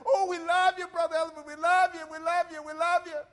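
hammed-up crowd fawning over the pastor; imitating church members gushing; mock adoring congregation.

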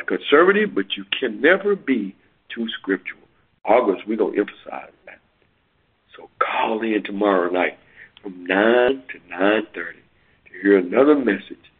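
ordinary talk, nothing marked

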